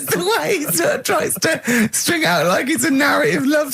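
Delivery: laughing protest. Real, not acted. Laughing while protesting the story.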